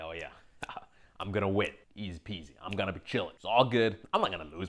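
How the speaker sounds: low, soft, unbothered mutter; lazy chill murmur. Retold or imitated. Imitating the calm inner voice, low and soft. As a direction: brush it off like it's nothing.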